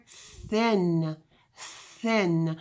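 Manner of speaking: "thin" quietly with an unvoiced, airy th; voiceless th, softly. Shown as a demonstration. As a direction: breathe the th in "thin", no buzz. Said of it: "say the word".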